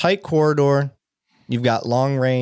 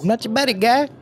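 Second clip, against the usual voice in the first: much higher, teasing buddy voice; squeaky mock of a tough guy; 11 semitones higher than usual. Mocking the cop's buddy talk.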